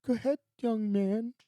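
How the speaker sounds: the principal waving the boy through, in a high put-on voice; imitating the stunned principal. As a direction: play the principal backing down, suddenly meek. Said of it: "He's like".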